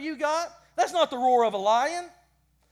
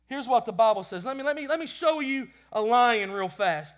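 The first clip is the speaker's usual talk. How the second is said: ordinary talk, nothing marked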